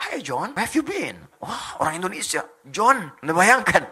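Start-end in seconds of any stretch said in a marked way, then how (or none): 0.1-1.2 put-on slow, swooping English-accented greeting. Indonesian greeting John like an Englishman, drawn-out English accent. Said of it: "kayak orang Inggris". 2.6-3.1 put-on loud, high English-accented name call. Indonesian greeting John, imitated English accent. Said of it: "kayak orang Inggris".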